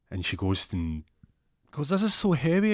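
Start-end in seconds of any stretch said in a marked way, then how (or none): 1.9-2.7 high, loud put-on voice; straining complaint. Struggling to lift the parcel, high loud complaint. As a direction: complain loudly in a raised voice. Acted complaint lifting heavy load.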